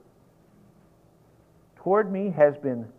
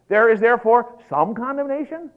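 loud, high, questioning "some"; mock misreading; 6 semitones higher, 7 dB louder than usual. Shown as a demonstration. Preacher testing listeners with a wrong verse, mock doubt on "some". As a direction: misquote it incredulously, lifting on "some". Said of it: "What about no, don't you understand?".

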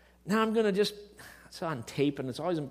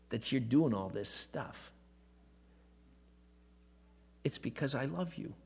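tender divine voice; 4 dB quieter than usual. Retold or imitated. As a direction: speak like a loving father soothing a worn-out son. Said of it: "Spirit of God says".

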